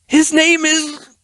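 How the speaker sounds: high, slow desperate plea; in desperation. Acted. Ruffian desperately refuses Erilyn, high and slow. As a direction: plead in desperation.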